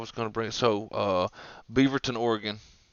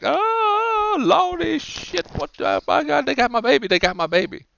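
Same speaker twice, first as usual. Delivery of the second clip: mock panicked mother; 14 semitones higher than usual. Mother whose car was taken with her baby, mock hysterical wail. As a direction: wail like a hammed-up frantic mom. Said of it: "You can imagine she's like".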